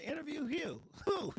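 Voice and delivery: high, loud disbelieving squeak; teen asking his mother, high and incredulous. Retold or imitated. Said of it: "And I said".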